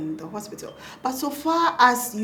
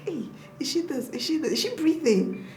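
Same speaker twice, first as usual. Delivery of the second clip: higher-pitched retold panic; anxious questions, pitched up and hushed; freaking out; 6 semitones higher than usual. New mother checking if baby is breathing, retold freak-out. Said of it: "I was just freaking out".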